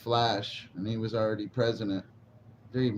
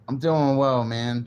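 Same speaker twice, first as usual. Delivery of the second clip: loud cheerful greeting; 3 semitones higher, 7 dB louder, slower than usual. Real, not acted. Greeting chat while smoking.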